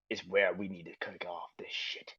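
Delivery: soft unvoiced whisper. Real, not acted. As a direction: whisper it like a secret. Whispered teaser.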